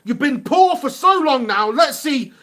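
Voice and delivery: loud, high cry urging a player on; urging shout. Real, not acted. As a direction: yell at the player to do something.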